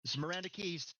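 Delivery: lightly voiced officer answering the radio; a hint of a crisp commander. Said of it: "you hear Miranda pick it up".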